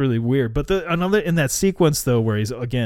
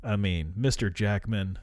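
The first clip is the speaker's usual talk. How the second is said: usual speaking voice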